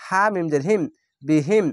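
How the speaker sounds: usual speaking voice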